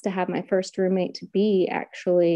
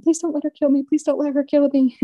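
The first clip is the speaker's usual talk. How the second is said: higher, pleading mock prayer; frightened prayer; 8 semitones higher, 4 dB louder, faster than usual. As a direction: beg it under your breath in panic.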